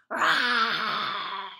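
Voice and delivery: loud playful lion roar. Pretend. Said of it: "Can I hear your very best lion roar?".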